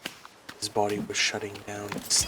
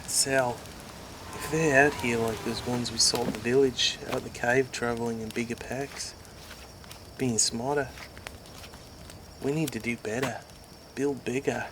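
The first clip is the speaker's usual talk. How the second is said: Richard's voice; 3 semitones higher than usual. Acted. Richard urging Sal to build better defenses, earnest. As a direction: urge your friend to build.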